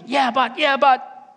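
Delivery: high, insistent yeah-but; protesting objection. Acted. Voicing an objection amid emotions.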